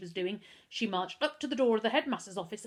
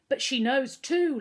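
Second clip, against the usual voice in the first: raised, slow insistent yell; 6 semitones higher, 7 dB louder than usual. Acted. Boy yelling at his mother to believe him, loud. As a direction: push it out loud and stubborn. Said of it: "Lloyd yelled".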